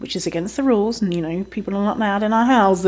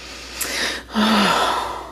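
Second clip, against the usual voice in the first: long breathy sigh of frustration; sighing at a hopeless situation; weary sigh; 3 dB quieter than usual. Real, not acted. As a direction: let out a fed-up sigh. Exasperated with the police reply.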